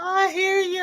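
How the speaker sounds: high, laughing through tears; words squeezed out laughing. Real, not acted. Laughing at panda joke.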